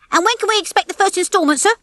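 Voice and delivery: quick, high-pitched call; calling after. A narrator's reading. Nick calls after Rocky about payment, calling out. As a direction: call after someone walking off. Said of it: "Nick called after him".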